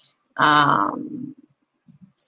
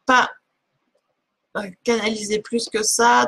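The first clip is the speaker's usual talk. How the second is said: usual speaking voice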